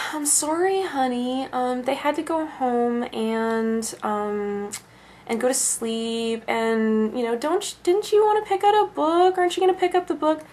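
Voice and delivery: high, soft, drawn-out coaxing; placating mother. Retold or imitated. Mother coaxing her upset daughter toward a book, placating. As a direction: soothe the child in a small high voice. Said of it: "And the mother's going".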